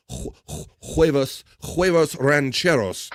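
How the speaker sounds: "huevos rancheros" said with a Spanish accent, "huevos" as hweh-vos, rolled Spanish vowels, slowed down; careful Spanish "huevos rancheros" at a slow pace. Shown as a demonstration. Host tries the dish name with a proper Spanish accent. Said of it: "let me say this with a proper accent".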